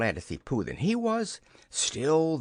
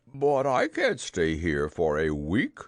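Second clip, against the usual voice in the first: low, plaintive protest; hammed-up storybook dismay; dismayed bear; 5 semitones lower, 4 dB louder than usual. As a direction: object woefully in a bear's voice. Pooh protests a week stuck.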